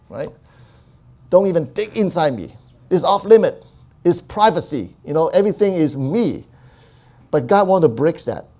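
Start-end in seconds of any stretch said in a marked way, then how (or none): 1.3-6.5 defensive outburst. Retold or imitated. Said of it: "Some of the people are so enclosed".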